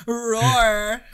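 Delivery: high, drawn-out playful roar; mock shark roar. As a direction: stretch a high pretend roar.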